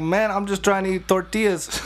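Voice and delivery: put-on Mexican accent. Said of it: "my impression of a Mexican joke".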